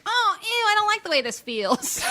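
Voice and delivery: high, drawn-out mock dismay; retold squeamish reaction. Moviegoer telling friends how the twist made her squirm, exaggerated squeamishness. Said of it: "And this one, I was like".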